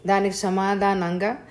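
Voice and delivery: ordinary delivery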